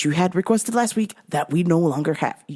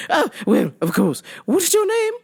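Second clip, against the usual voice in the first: higher-pitched phony English lady; phony English accent; 10 semitones higher than usual. Retold or imitated. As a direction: put on an affected English accent. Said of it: "phony English accent".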